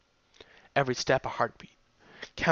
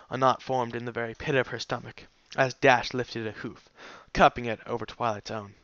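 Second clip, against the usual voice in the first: ordinary delivery, nothing marked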